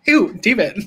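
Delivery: a playful hint of sitcom character disgust; Schitt's Creek impression. Fan quotes Schitt's Creek for viewers, playful mock disgust. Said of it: "do my Moira Rose Schitt's Creek impression".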